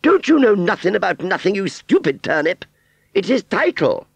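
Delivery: very high, loud scorn; condescending aunt. Acted. Aunt Sally pitying ignorant Wurzel, condescending. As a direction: put down the dimwit from on high. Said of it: "Aunt Sally smiled pityingly".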